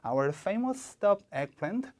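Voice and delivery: "famous" stressed with a rising tune; slow, clear model line for repeating; slow model reading. Shown as a demonstration. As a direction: stretch "Our famous stuffed eggplant" slowly for students to copy. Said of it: "하나씩 따라해 보실까요?".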